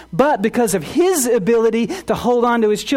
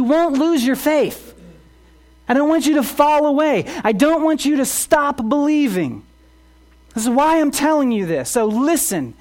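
higher and louder pleading sermon; impassioned preaching; 6 semitones higher, 4 dB louder than usual. Acted. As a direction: urge them not to fall away. Pleads at full force.

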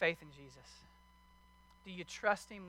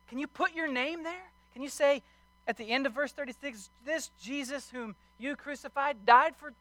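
higher, louder preaching that keeps building; fiery pulpit preaching, voice raised high; full-force preaching; 7 semitones higher, 7 dB louder than usual. Acted. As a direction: preach it hard to the congregation. Preaching continues.

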